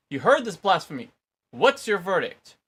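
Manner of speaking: loud, high, outraged accusation; furious outcry. A narrator's reading. High priest to the council, loud and outraged. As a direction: roar the charge of blasphemy.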